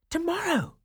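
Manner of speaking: high delighted cry; excited crowd. Acted. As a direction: echo it thrilled.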